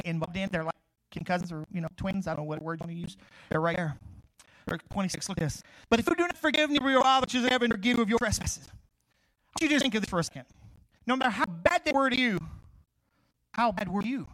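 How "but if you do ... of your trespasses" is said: preaching scripture. Acted. Reading scripture.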